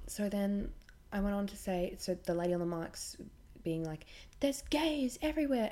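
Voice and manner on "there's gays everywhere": high, loud mock of a ranting preacher; hammed-up preacher shriek; mock screaming preacher. Mocking the screaming mic preacher.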